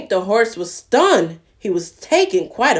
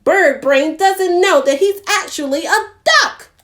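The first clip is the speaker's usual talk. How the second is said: high, loud fussing goose; flustered goose; 8 semitones higher, 4 dB louder than usual. Acted. Gilly Goose fussing loudly about Doddle. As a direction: fuss like a frazzled goose. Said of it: "flustered and she fussed".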